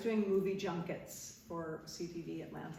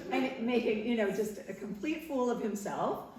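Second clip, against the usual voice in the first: normal talking voice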